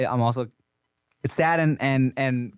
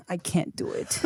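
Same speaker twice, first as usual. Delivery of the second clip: put-on whiny child whisper; 4 semitones higher, 7 dB quieter than usual. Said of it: "she'll fucking throw it".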